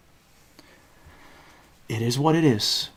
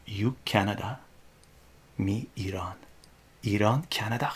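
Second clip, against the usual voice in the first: quiet menace; 2 semitones lower, 7 dB quieter than usual. Retold or imitated. New kid facing down three bullies, quietly menacing. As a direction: say it softly in halting fragments. Retold warning in broken English.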